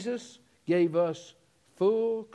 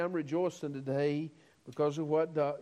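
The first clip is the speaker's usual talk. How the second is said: ordinary talk, nothing marked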